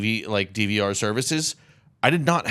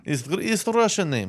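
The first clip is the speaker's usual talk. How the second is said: mock Russian accent; 7 semitones higher than usual. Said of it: "Hello, I'm Russian".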